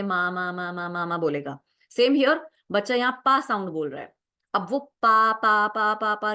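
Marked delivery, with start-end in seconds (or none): 0.0-1.1 even, running string of "माँ"; demonstrated babbling. Shown as a demonstration. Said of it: "again शायद attention लेने के लिए". 5.0-6.3 firm, even string of "पा"; demonstrated babbling. Shown as a demonstration. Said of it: "repeat करे जा रहा है".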